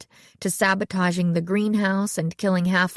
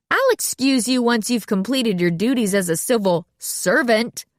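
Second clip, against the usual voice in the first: "servant" pointedly leaned on, higher voice; stress on servant; 5 semitones higher, 3 dB louder than usual. A narrator's reading. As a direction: twist the word servant. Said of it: "emphasizing the word servant".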